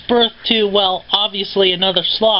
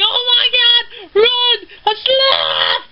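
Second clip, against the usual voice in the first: much higher, slow terrified shout; panicked yell. Retold or imitated. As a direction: scream a warning to run. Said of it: "It's like".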